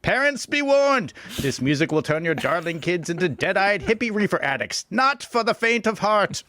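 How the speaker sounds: high-pitched, hammed-up alarmist announcer; over-the-top moral-panic warning; silly impression voice. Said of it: "that's the end of the impression era".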